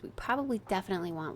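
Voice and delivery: ordinary talk, nothing marked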